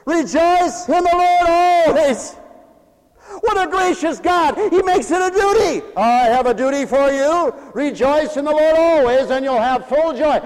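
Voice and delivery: high, loud preaching; full-force preaching. Acted. Preacher proclaiming God's duty to rejoice, high and loud. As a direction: ring it out over the church.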